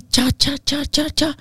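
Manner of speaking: high punchy chop sounds; chopping sound effect. Retold or imitated. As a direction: mimic machete blows rhythmically. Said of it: "voltava a bater". (imitando golpes, tchá agudo)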